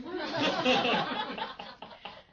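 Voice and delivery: a high bubbling laugh. Real, not acted. Teacher laughing at himself with students, high bubbling laugh. Laughing at his own joke.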